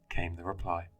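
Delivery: ordinary delivery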